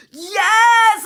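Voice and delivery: a high, loud cheer of yes; excited shout. Retold or imitated. Husband reading her text, shouting yes in joy. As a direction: shout it like he just won. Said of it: "Like, he's like".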